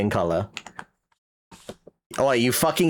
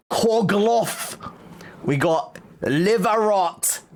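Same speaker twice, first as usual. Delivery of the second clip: slow, higher fake French menu read; mock French accent; 6 semitones higher, slower than usual. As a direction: mangle the names like a mock waiter. Reading French dishes aloud.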